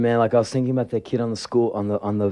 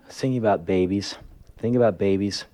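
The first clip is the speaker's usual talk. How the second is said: ordinary delivery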